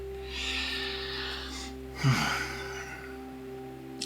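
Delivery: long, breathy sigh; unhurried exhale. Real, not acted. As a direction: let out a slow sigh. Sighs between thoughts.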